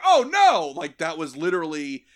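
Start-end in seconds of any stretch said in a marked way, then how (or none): 0.1-0.7 high, loud mock-horrified cry; mock panic. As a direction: wail it in pretend dread. Acted victim reacting to threat.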